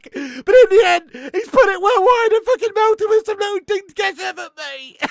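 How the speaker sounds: real outrage and glee at a botched penalty; incredulous shouting rant. Real, not acted. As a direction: shout it like you can't believe what you saw. Ranting about a terrible penalty.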